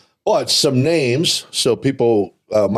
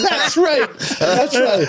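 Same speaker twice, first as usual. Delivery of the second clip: high-pitched, laughing through the words; much slower than usual. Real, not acted. Wrestler cracking up at the co-host's war joke, laughing high. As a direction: giggle it out while agreeing.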